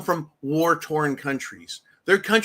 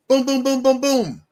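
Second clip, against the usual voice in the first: higher, louder booms imitating gunfire; mock gunshots; 8 semitones higher, 5 dB louder than usual. Host imitating homeowner firing at intruders, mock gunshot booms. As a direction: punch out each boom like a shot.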